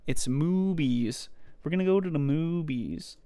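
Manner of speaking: movies said as moobies, with a b; playful mispronunciation. Pretend. Joking with a friend about funny words, moobies. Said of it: "It's moobies".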